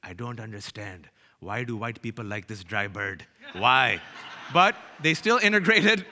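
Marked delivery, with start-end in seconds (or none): 0.0-4.0 mother's voice. Retold or imitated. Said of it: "my mother says".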